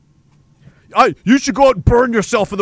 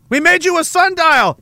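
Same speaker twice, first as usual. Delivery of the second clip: pleading villagers; 9 semitones higher than usual. Pretend. Villagers offer the sun a sundial, high and eager. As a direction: present the sundial as a desperate gift. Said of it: "They're like".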